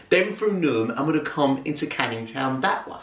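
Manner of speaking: ordinary talk, nothing marked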